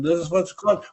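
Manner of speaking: a hint of a Russian scientist; Russian accent. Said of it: "your efforts to, uh, do a Russian accent are duly noted".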